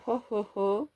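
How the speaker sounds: a light Santa Claus ho ho ho; Santa laugh. Pretend. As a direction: tosses off a jolly Santa chuckle. Christmas papers, Santa laugh. (petit rire de père Noël, à peine joué)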